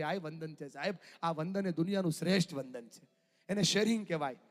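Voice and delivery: usual speaking voice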